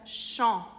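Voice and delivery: slow "champ" as "shon", P silent; French nasal AM. Shown as a demonstration. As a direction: draw out "champ" on its nasal vowel. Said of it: "é o mesmo som de A N". ("champ" devagar, com vogal nasal)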